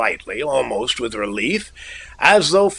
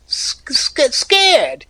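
much higher, breaking on S-, sc- before scared; frightened stammer; 14 semitones higher, much slower than usual. A narrator's reading. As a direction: stumble over the word in fear. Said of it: "he stammered".

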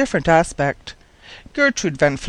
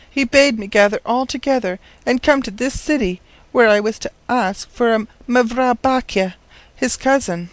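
put-on high-pitched, earnest girl's voice; forlorn orphan girl; 6 semitones higher than usual. Orphaned girl explaining to the professor why she came, forlorn. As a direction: explain it plaintively in a higher voice.

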